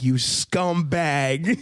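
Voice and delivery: mock scolding Jesus. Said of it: "Jesus was not like".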